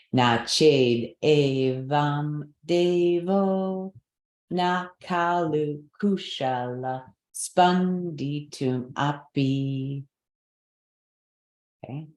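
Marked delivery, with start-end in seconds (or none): 0.1-10.0 slow, lifted Sanskrit chant; slow recitation together. Shown as a demonstration. Teacher leading the next line together, slow chant. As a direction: draw each syllable out with the class. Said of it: "let's try together".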